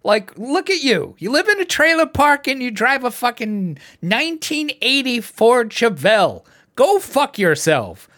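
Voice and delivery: high, loud exasperated yelling; venting at trailer park voters, high and loud; angry rant. Retold or imitated. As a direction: tell them off at the top of your voice. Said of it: "I'm like".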